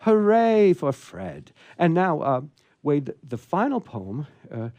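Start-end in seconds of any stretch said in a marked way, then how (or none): 0.0-1.5 drawn-out high hooray; cheer. Acted. Host cheering after Fred's valentine poem, slow and high. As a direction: cheer Fred on slowly and high.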